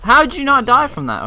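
high, loud disbelief; surprised. Real, not acted. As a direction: blurt it out in shock. Surprised the mob survived.

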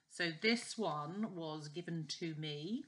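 usual speaking voice

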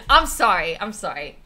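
ordinary delivery

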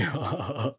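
a short smug laugh; the gorilla chuckling at its own joke; gorilla chuckle. Acted.

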